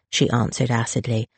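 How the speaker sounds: ordinary delivery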